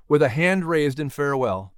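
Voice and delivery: usual speaking voice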